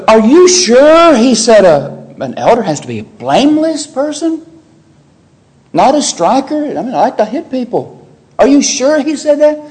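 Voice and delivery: high, sceptical voice of a quarrelsome member; doubting churchgoer. Retold or imitated. Preacher mimics a church member doubting the rule, high and sceptical. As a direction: push back, unsure and suspicious. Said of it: "somebody will say".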